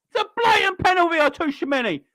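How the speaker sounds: high, strained, still protesting; indignant shout. Real, not acted. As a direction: keep yelling the appeal.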